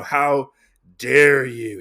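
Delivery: loud, drawn-out mock outrage on "dare"; retold indignation. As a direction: act out being appalled, punching "dare". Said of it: "He gets all mad".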